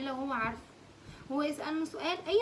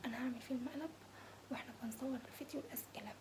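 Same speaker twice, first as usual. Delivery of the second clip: ordinary delivery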